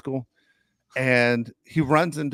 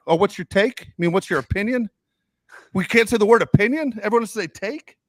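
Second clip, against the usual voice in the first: mock indignation; 7 semitones higher, 4 dB louder than usual. Mocks the buzzword, indignant.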